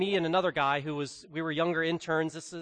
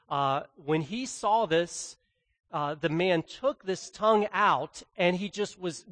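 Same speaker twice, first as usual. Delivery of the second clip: usual speaking voice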